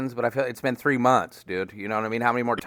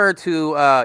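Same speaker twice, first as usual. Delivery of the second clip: ordinary delivery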